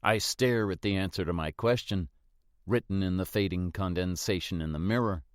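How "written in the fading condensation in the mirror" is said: usual speaking voice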